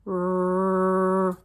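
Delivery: "r" held long on a steady note, "rrrr"; one continuous "r" hum; stretched-out r. Shown as a demonstration. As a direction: hold "r" with no vowel after it. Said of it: "This sound says".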